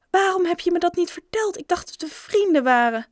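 high, loud, wounded reproach; indignant reproach. A narrator's reading. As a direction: confront a friend who kept a secret. Bob hurt, confronts Joe over secret. (verontwaardigd, hoog en luid verwijt)

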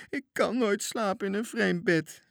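high, whiny crying voice; crying scared boy. Acted. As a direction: whimper like a frightened child. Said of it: "huilde bange Toontje". (bange Toontje huilt in een vreemd bed)

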